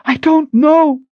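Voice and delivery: high, loud, panicked answer; desperate cry. A narrator's reading. Sister answering which way to go, desperate cry. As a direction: throw it out high in despair. Desperate reply amid danger.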